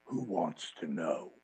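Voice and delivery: low menacing growl of a wrestler; menacing tough guy. Retold or imitated. Imitating Jake the Snake's menace.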